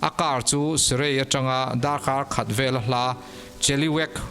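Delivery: usual speaking voice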